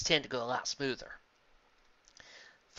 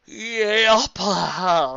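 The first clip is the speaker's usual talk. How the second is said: higher, loud character voice; 8 semitones higher, 14 dB louder than usual. A narrator's reading. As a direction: voice the pony loudly. Character voice in read story.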